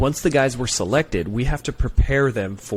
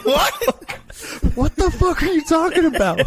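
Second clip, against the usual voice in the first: much higher, louder disbelieving shout; baffled yell; 5 dB louder than usual. Retold or imitated. Recruiter yells back at the recruit in stunned disbelief.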